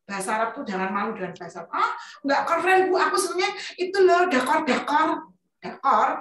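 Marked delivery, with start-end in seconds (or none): 1.7-5.1 mimicking a pupil.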